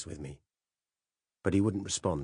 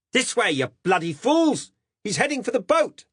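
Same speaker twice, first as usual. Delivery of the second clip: arrogant shout; 10 dB louder than usual. Acted. Narrator bossing the cops as their senior, arrogant shout. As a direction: yell at them like a superior officer. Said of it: "I shouted, with the sort of arrogance".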